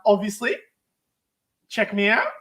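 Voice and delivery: ordinary talk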